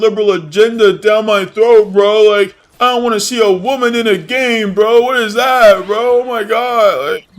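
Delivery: high, loud sneering whine; mocking whiny gamer. Streamer parodies gamers whining about a woke game, in a high loud whine. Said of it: "Oh, well".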